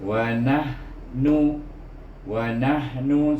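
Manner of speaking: nahnu with breathy h; slow nahnu, airy h; over enunciating. Shown as a demonstration. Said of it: "ada bunyi hama angin".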